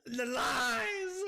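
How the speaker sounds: high, drawn-out outraged cry; betrayed scream. Real, not acted. Streamer who was lied to about water, betrayed scream. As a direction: wail it in loud mock betrayal. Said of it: "That scream just made Summer jump".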